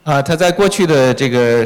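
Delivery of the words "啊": ordinary delivery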